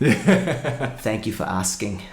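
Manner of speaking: slow, deliberate activist impression; James Aspey. Shown as a demonstration. Guest imitates an activist breaking his silence, slow impression. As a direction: imitate him slowly, on the edge of laughing. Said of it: "I did a good James Aspey when he broke his silence".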